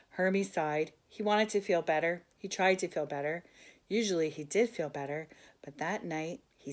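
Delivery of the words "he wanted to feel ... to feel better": ordinary delivery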